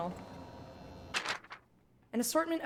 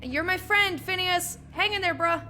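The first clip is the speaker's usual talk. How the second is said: loud, high call to a friend; shouting; 9 semitones higher, 7 dB louder than usual. Real, not acted. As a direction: shout across the room. Calling out to captured friend.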